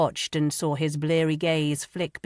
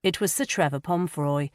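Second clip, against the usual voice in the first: plain reading voice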